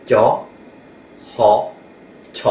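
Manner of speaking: TIA, HA, then aspirated TIA, with gaps; sound-blending demo. Shown as a demonstration. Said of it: "Eh bien, je fais".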